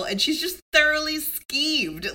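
ordinary delivery

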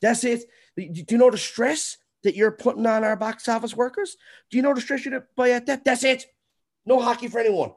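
lightly hammed-up offended bureaucrat; mocking the city. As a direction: parody the city's huffy reply. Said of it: "they're like".

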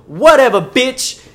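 high, loud mock dismissal; dismissive snap. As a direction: toss it off rudely. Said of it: "And she goes".

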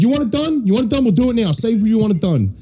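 high, repeated insistent jabs; imitated heated retort. Boss arguing with the Chinese client, imitated heat. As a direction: fire back in a high voice.

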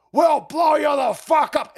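slow, high, hammed-up shout; mock shouted threat. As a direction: yell the threat like a raving caricature. Repeats mocked threat, escalating.

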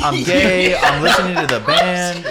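laughing through the words. Real, not acted. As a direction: giggle out the lines, loud. Laughing while telling story.

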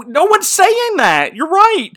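high, loud squeal of agreement; mock squeaky yes-man. Sycophantic lord squeaking his agreement to Miraz, very high and loud. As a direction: squeal it way up high like a flustered lackey.

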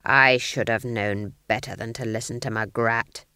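low, menacing growl; growling menace. A narrator's reading. Nanny growls at Granny, too angry to shout. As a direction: sink the pitch well down and growl it. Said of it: "growled Nanny".

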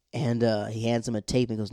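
ordinary delivery

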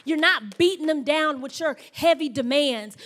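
rising sermon intensity; preaching. Acted. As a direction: drive the point home to the congregation. Preaching builds up.

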